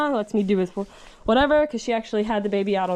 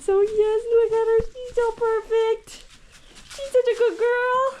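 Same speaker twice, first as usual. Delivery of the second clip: doting praise; 10 semitones higher than usual. Real, not acted. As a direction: coo over the perfect foal, pitched up. Praising the newborn foal.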